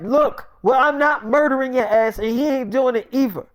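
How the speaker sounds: acted indignant insistence; firm refusal. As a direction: put your foot down, worked up. Retold refusal to kill friend.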